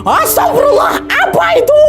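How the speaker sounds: high-pitched frightened cry; a friend spots something on the mountain, yelling in fright; panicked yell. Retold or imitated. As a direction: blurt it out in a sudden scare.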